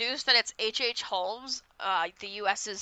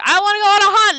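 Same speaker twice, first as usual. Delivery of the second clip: high, loud retold shout; 12 semitones higher, 14 dB louder, much faster than usual. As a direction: yell it like an angry fight. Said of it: "Joe and Ellen are screaming at each other".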